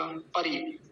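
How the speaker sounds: परि said quickly, with a brief final i; short इ. Shown as a demonstration. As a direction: keep the रि of परि short and soft. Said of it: "तो जल्दी बोला जाएगा". (परि में छोटी इ, जल्दी से)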